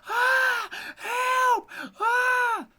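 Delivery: very high, loud screams for help; screaming for help. A narrator's reading. Hansel locked behind the grating screaming for help, shrill loud cries. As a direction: shriek for help like a trapped boy. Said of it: "call and scream".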